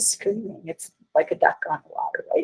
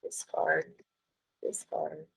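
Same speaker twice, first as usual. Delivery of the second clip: muttered under her breath, much softer; 13 dB quieter than usual. Real, not acted. Teacher muttering to herself while closing files. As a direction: mutter it to yourself while clicking. Clicking through dialogs, muttering.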